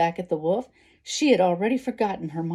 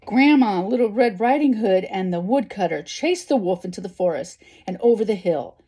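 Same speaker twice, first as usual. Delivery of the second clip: lively, animated storybook narration; story reading to children; 6 dB louder than usual. A narrator's reading. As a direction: read the action out with big emphasis. Reads a story aloud.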